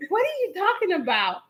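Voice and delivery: high, incredulous imitation; baffled. Husband answers accusing wife, imitated bafflement.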